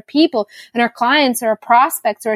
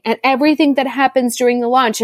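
usual speaking voice

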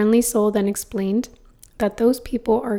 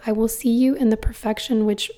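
ordinary delivery

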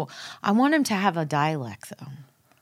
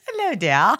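put-on tag English accent. Echoes his attempted English accent.

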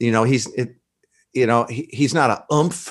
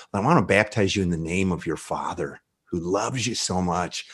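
tender blessing; 6 semitones lower, 7 dB quieter than usual. Retold or imitated. Baptizing friend in Father's name.

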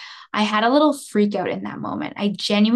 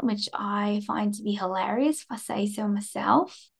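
a lightly put-on Australian accent, softer; bad Aussie accent; 4 dB quieter than usual. Shown as a demonstration. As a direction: try an Australian accent and miss a little. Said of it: "Okay, that one was terrible".

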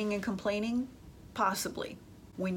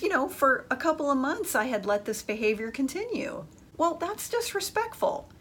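ordinary delivery

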